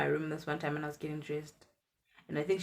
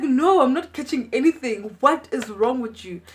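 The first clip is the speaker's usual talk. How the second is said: high, loud aunt brushing it off; aunt refusing the request, high and loud; loud refusal; 7 semitones higher, 10 dB louder than usual. Retold or imitated. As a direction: shout the refusal.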